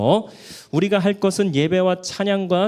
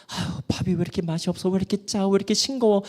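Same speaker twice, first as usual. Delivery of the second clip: mock grumbling; 4 semitones higher, faster than usual. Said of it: "불평하고 싶은 분 계세요".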